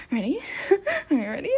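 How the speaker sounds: ordinary talk, nothing marked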